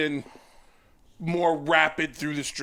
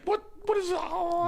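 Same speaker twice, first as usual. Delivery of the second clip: freaking out; slower than usual. Retold or imitated. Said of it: "she's freaking out".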